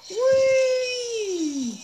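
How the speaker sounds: long high shout of victory; triumphant yell. Real, not acted. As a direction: let out a loud, drawn-out cheer. Boss finally beaten.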